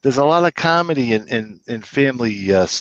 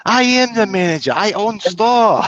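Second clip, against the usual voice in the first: Korean lady's voice; 9 semitones higher than usual. Retold or imitated. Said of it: "Where's your manager?".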